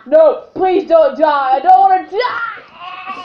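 slow, high, loud wailing plea; desperate pleading. Pretend. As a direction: beg not to die, drawn out. Character dying in the game.

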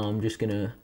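usual speaking voice

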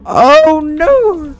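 high, very loud cry of dismay; headphone-wrecking wail; loud scream. Real, not acted. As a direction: scream it out, high and loud enough to hurt ears. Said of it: "rip headphone users".

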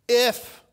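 high, loud, drawn-out "If"; stressed if. Acted. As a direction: hit "If" hard and high before a pause. Preacher stressing the word if.

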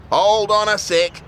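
sudden loud high blurt. A narrator's reading. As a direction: blurt it out to stop everyone. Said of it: "she blurted out".

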